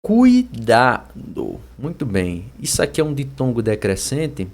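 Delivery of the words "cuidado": drawn-out CUI-DA-DO, each syllable apart; stretched, separated syllables in "Cuidado". Shown as a demonstration. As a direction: sound out "Cuidado" slowly for a class. Teacher breaks word into syllables.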